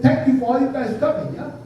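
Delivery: high, lively Hawaiian pidgin with a tag "yeah?"; Hawaiian pidgin announcement. Retold or imitated. Priest inviting mourners to the luau in Hawaiian pidgin, high. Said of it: "I said".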